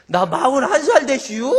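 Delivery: high, loud Chungcheong-accented protest, imitated; Chungcheong dialect retort. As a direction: correct them with a rising Chungcheong ending. Man's indignant dialect reply, acted.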